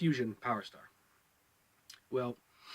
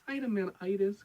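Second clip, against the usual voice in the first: Dynamanitis? pitched higher as a baffled question; incredulous; 6 semitones higher than usual. Retold or imitated. Reviewer scoffing at the show's made-up illness name, incredulous. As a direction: repeat Dynamanitis like you can't believe it. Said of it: "I'm like".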